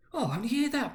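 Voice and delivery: delighted exclamation. Acted. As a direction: marvel at the news. Mother delighted at news.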